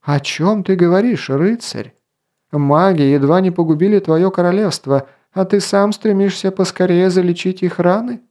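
high-pitched, heated challenge; indignant exclamation. A narrator's reading. Elf rebukes the king for sparing the mages, exclaiming. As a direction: reproach him in disbelief. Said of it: "воскликнул Орелий".

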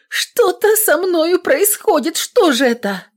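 high, frightened pleading; panicked. Acted. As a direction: panic at the pain. Woman in labour, frightened. (испуганно)

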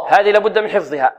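usual speaking voice

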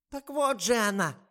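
высокий громкий возглас; настаивает, как глуховатая старушка; громкий старушечий возглас. A narrator's reading. Старуха кричит. (громкий старушечий возглас)

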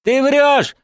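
very high defiant cry; shouted retort. A narrator's reading. Zagir answers his accuser, shouting back high. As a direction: cry out the denial. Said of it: "крикнул в ответ Загир".

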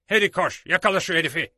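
loud, commanding call to chase; loud order. A narrator's reading. Silver calling his man Harry to catch the thief, loud command. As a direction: bark the order to Harry across the room.

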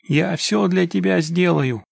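drawn-out, imploring wail, understated; lamenting plea. A narrator's reading. Father promises anything for help, slowly lamenting. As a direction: plead on and on, slowly. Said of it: "продолжал причитать мужчина".

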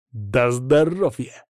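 slow growl with rolled, drawn-out sounds; stubborn boy growling in protest. A narrator's reading. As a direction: growl it out defiantly. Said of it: "прорычал пацан".

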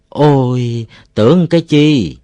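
high, loud "is that all?" reaction; relieved exclamation. A narrator's reading. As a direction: exclaim after a false alarm. Villagers relieved after false alarm.

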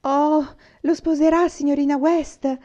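put-on a child desperate for a yes; anxious question. Una anxiously asks Miss West, eager. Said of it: "chiese Una ansiosa".

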